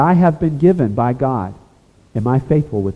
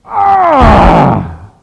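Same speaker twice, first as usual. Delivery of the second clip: sudden loud, high cry through clenched teeth; anguished gnashing cry; 11 semitones higher, 9 dB louder than usual. Shown as a demonstration. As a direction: let out a howl of anguish, teeth bared. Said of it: "It's where you go".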